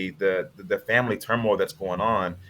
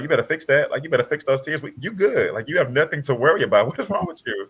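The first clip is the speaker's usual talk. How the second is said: higher, teasing mother's scolding with a laugh; scolding mom; 7 semitones higher than usual. Retold or imitated. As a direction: mom playfully telling her son to stop crying. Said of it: "she looks up at me and was like".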